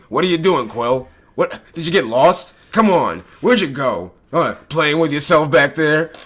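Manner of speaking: loud taunting raccoon heckle; Rocket voice. Retold or imitated. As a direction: heckle loudly like a cocky raccoon. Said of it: "that's Rocket, who always talks shit".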